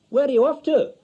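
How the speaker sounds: loud, high call from the next room; husband calling after his wife. A narrator's reading. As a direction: shout down the hall.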